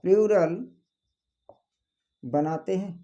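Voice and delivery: word-by-word dictation with long pauses; slow dictation. Acted. Teacher giving students the exception rule, slow dictation. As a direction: dictate slowly, leaving time to write.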